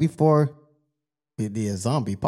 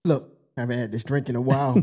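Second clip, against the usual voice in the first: ordinary talk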